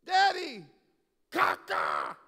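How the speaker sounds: very loud, high mock child's call; loud toddler yell. Toddler calling to his preacher dad mid-sermon, loud imitated yell. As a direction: imitate a toddler hollering across a room. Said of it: "And right out loud".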